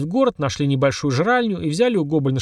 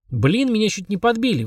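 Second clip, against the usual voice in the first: higher-pitched protest; indignant; 7 semitones higher than usual. A narrator's reading. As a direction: complain in mild outrage, pitched up. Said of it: "возмутилась Полина". (возмущённо, высоким голосом)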